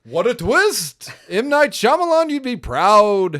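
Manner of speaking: mock twist reveal. Co-host hailing an absurd plot twist, mock-amazed. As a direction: proclaim it loudly, drawing out each word.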